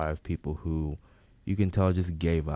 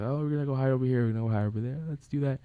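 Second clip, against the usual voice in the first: lightly voiced excited planners, higher pitch; quick, higher mock chatter; mimicked party guests; 7 semitones higher, much faster than usual. Party guests planning where to hide, mimicked.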